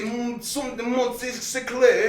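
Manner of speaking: slow mock French gibberish; fake French. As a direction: drawl fake French words. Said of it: "Did he say".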